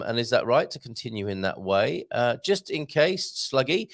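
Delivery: normal talking voice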